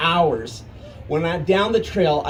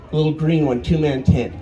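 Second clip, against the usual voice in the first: usual speaking voice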